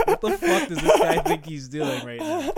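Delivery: very high, loud, cracking-up outburst; laughing outrage. Retold or imitated. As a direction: shout the mock outrage through laughter. Retells own outraged reaction, laughing.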